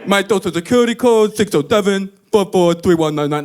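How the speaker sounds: dropping the deaf act; clear plain speech. Retold or imitated. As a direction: suddenly articulate confession. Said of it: "by the time they were leaving".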